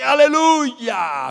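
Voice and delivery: long drawn-out Aleluia, high and very loud; joyful shout. Retold or imitated. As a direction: cry Aleluia out in praise. Ecstatic worship shout.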